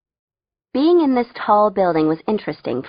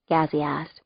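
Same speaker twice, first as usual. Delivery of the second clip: usual speaking voice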